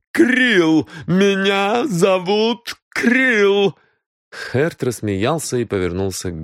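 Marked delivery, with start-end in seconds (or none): 0.1-3.8 high, loud, slowed name; shrill insistence. A narrator's reading. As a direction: claim your name fiercely. (миссис Крил яростно настаивает на имени)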